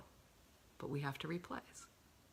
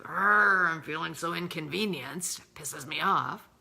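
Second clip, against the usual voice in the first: loud, annoyed growl of being put out; mock a resentful outburst; irritated grumble; 10 dB louder than usual. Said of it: "holding the mindset of".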